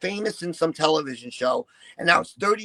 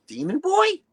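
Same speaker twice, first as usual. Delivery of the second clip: surprised; 11 semitones higher, 4 dB louder than usual. Retold or imitated. Fan recognizes him in store.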